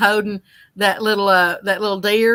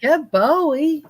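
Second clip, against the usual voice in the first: slow higher praise to a dog; 6 semitones higher, slower than usual. Acted.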